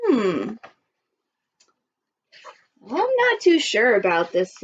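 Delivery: plain reading voice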